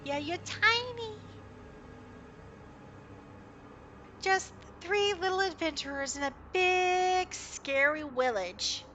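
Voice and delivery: put-on high, sing-song baby talk; cutesy teasing. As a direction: coo it like talking to toddlers. GM teasing tiny adventurers.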